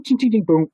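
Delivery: ting ting boom. Retold or imitated. Imitating silverware then a crash.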